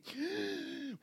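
high-pitched theatrical gasp; mock amazed gasp. Listener pretends to lick a tree, mock amazed gasp. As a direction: gasp as if discovering a flavor.

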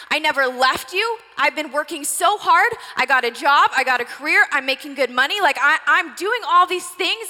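loud, hurt outburst at her mother; mad daughter. Acted. Older daughter confronting her mother, loud and hurt. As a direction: pour out years of resentment, loud. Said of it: "She's so mad".

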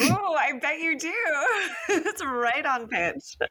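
high, teasing laugh through the words; giggling, delighted disbelief. Real, not acted. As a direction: crack up while teasing him. Teasing about his singing.

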